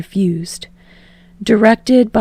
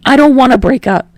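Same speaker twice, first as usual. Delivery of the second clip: higher, louder desperate plea; 5 semitones higher, 7 dB louder than usual. Acted. Kirishima begging Bakugo not to end it, desperate. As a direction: plead as if losing him. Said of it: "Kirishima says desperately".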